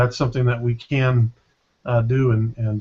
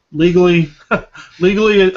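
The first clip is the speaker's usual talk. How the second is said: LEGALLY louder and higher, with a chuckle between; stress on legally; 5 semitones higher, 7 dB louder than usual. Real, not acted. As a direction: punch "legally" as a joking disclaimer, loud and raised. Jokingly stressing that clips were legal.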